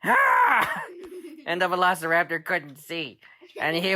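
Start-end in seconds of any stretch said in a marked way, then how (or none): 0.0-0.8 high sudden monster shriek; a shrill startled dinosaur cry; raptor scream. Acted. Dad voicing the blinded velociraptor with a high shriek. Raptor hit by exploding egg.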